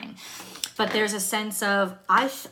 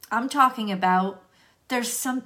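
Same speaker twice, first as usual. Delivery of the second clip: ordinary delivery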